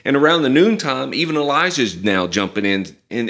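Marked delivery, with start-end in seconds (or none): none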